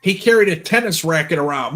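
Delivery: ordinary delivery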